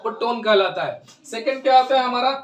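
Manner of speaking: usual speaking voice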